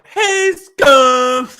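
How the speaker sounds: high, loud mock call of a greeting; wife's greeting. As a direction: shout it like the wife would. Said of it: "that's how my wife thinks we start the show".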